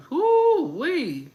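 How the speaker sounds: high, loud whoop; whoo-wee. Real, not acted. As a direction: let out a delighted whoop at the drama. Reacting to messy drama.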